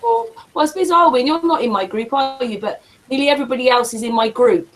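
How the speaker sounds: ordinary talk, nothing marked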